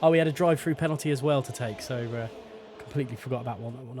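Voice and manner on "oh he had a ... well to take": ordinary delivery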